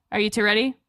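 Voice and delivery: ordinary talk, nothing marked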